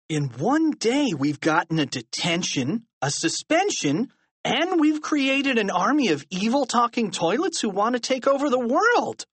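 put-on increasingly distressed boy's complaint; mounting dismay. A narrator's reading. Harold tallies their disasters in dismay.

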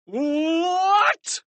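high-pitched shriek of disbelief; outraged scream. A narrator's reading. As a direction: scream it high, stretching the word. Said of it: "screamed Mister Krupp".

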